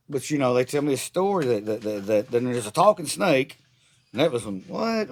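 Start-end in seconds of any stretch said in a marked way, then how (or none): 4.7-5.1 incredulous what. Real, not acted. Mock disbelief at talking snake.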